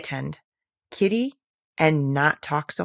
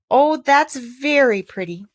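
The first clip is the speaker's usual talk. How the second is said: slow, bright exclamation, higher and louder; delighted cry; 7 semitones higher, 5 dB louder, slower than usual. Acted. Alice clapping her hands in delight at the snow story, crying out. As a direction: gush with delight, drawing it out. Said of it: "cried Alice".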